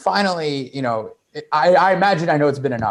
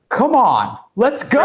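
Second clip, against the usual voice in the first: unsmooth urging; 9 semitones higher, slower than usual. Retold or imitated. Desperate wingman urging his friend, pleading. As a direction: voice jumping high in impatience. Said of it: "this is where I, all the swiftness had gone".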